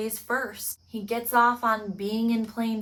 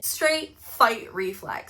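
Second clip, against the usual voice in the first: normal talking voice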